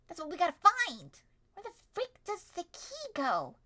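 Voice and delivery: ordinary delivery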